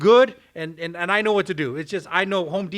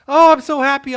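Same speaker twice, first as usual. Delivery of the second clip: much higher and louder, overjoyed wife; 9 semitones higher, 9 dB louder than usual. Retold or imitated. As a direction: burst out happily to your sister.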